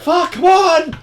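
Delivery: high, loud mock yell; acted frustrated shout; frustrated outburst. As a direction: erupt as the exasperated gunman. Acting out frustrated gunman dropping bottle.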